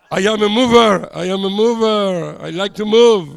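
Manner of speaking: loud, ringing declaration; loud proud boast; proud exclamation. Real, not acted. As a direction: call it out proudly to the room. Shouting proudly to congregation.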